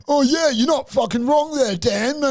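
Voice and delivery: lightly mock the dumb friend agreeing; mild mock of a drunk mate. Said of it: "his stupid mate's like".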